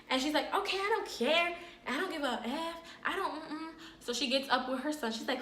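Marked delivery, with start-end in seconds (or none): 0.5-3.7 dismissive auntie. Retold or imitated.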